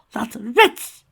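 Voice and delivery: high, loud, spat-out outburst; furious shriek. Acted. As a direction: shriek it like a betrayed diva. Rarity spits insult at rival.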